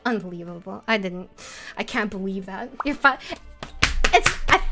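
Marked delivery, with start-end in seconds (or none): none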